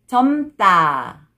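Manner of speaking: 젊다 joined as 점따, tense tt; read together. Shown as a demonstration. Teacher shows how it reads together, linked pronunciation. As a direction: link 젊다 with a hard 따. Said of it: "when you read it together".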